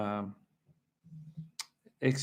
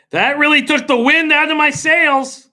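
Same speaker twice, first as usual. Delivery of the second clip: high, loud, fed-up exclaim; exasperated outburst; 13 semitones higher, 8 dB louder than usual. Real, not acted. Bettor venting after a losing race, loud and high. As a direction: blurt it out in frustration.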